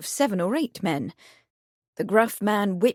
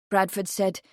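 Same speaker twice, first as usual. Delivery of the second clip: ordinary delivery, nothing marked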